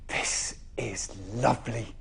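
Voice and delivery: whispered, slow and content. Acted. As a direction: sigh it out in a whisper. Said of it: "said Winnie".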